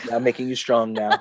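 low, soft mock-fatherly voice; Peter Griffin. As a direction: play the smug dad, amused. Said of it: "he's like".

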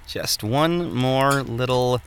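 drawn-out, slow, careful words; slow coaxing. Acted. Smith coaxing the final stroke, slow and careful. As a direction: ease it out slowly, under your breath.

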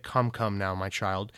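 low, soft fatherly comfort; Phil Spencer tender. Retold or imitated. Phil Spencer consoling the developers: soft, low tender voice. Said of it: "Phil Spencer will be like".

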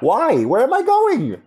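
high-pitched mock exasperation; exasperated self-questioning. Guest mocks own urgent speeding with nowhere to go, high exasperated questioning. Acting out a hurried New Yorker.

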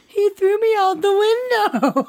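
high-pitched tattling, breaking into laughter; hammed-up outraged protest; mock-indignant complaint. Acting out Noah's complaint, laughing.

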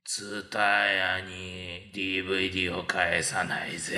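low, slow, smug comedian voice; Sugi-chan impression. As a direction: boast in a wild, cocky drawl. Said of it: "スギちゃん". (低くゆっくりしたワイルドな芸人口調)

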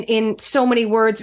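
ordinary talk, nothing marked

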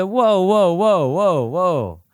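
high, startled whoas reining himself in. Retold or imitated. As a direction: catch yourself mid-snap, whoa whoa. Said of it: "I said".

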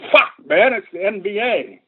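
sudden high shout at the troops; barked order. A narrator's reading. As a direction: bark the warning, pitch jumping up. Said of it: "The sergeant shouted".

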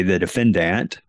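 defendant ending in a full DANT, not dunt; stressed final syllable. Shown as a demonstration. Former prosecutor quotes his courtroom habit, defendant with full DANT ending. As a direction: say de-fen-DANT, last vowel clear. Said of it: "or I say".

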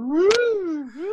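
high squeaky cartoon yelp of surprise; imitating a cartoon rubbing its eyes; mock double take. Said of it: "your eyeballs go".

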